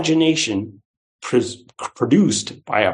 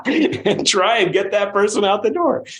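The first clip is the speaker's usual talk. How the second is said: laughing, higher-pitched aside; amused; 8 semitones higher than usual. Real, not acted. Speaker jokes about voting a politician out, amused. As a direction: start on a laugh, voice lifted.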